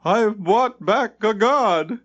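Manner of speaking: slow, high, proud announcement; triumphant proclamation, drawn out and high. A narrator's reading. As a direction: savour every word of the news. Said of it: "he said triumphantly".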